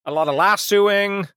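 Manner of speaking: mock-weary cowboy reminiscing; retired cowboy. Cowboy explains why he retired, lightly voiced cowboy.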